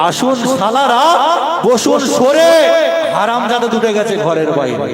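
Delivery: high, loud calling-out; loud eager welcome. Acted. Boy welcomes uncle and aunt at the gate, loud and eager. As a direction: greet guests at full voice, innocently eager. Boy calls out greeting to relatives.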